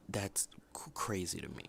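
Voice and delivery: whispered in disbelief; disbelieving whisper. Real, not acted. Vlogger stunned by the Oscar nominations, whispering. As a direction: breathe it out, stunned.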